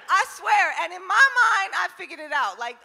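normal talking voice

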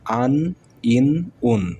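slow, stretched আন, ইন, উন with nasal n endings; drawn-out tanween vowels. Shown as a demonstration. Teacher sounding out tanween slowly for students. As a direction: draw out আন, ইন, উন slowly for the class. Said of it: "দুই পেশ আছে".

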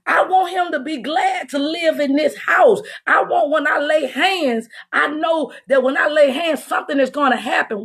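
usual speaking voice

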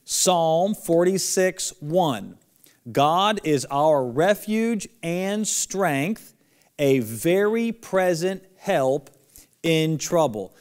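slow and loud, every word drawn out; teacher calling out a memory verse for kids to repeat; slow lead-along recitation. Shown as a demonstration. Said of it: "Following the magic jumping marker, everyone together, ready?".

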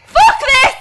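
loud frightened yell; panicked shout. Real, not acted. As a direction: shout it while fleeing. Fleeing the monster, shouting.

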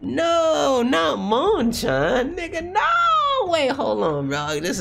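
high, stretched wail of denial; anguished protest. Real, not acted. Fan refusing to accept the dog Monchan dying, anguished. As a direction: squeal it out long, refusing to believe. Dreading the dog's death in story.

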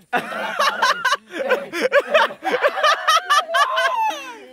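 long, high-pitched laughing fit. Real, not acted. Reacting to the misspelled answer, long high laughter.

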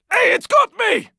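high panicked shout as it grabs you; panicked yell. Acted. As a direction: scream for help, caught. Grabbed by a special infected.

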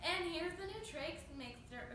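usual speaking voice

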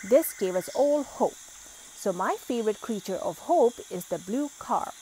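ordinary delivery

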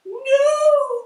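high, loud frightened no; terrified loud no; cried no. A narrator's reading. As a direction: refuse in panic. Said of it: "cried the witch".

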